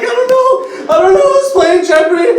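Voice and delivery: high, loud, laughing panic; panicked reply. Retold or imitated. Caught by the nurse, he panics high and loud. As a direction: blurt it out in a flustered rush. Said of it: "I'm like".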